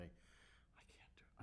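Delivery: whispered, imitating an embarrassed confession; mock whisper. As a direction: whisper it like owning up to something shameful. Quoting her call to the producers.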